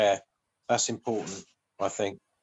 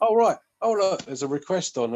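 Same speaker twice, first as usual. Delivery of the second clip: suddenly higher and louder, as if startled awake; mock surprise; 7 semitones higher, 5 dB louder than usual. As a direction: play jolting awake to a request. Acting out waking up surprised.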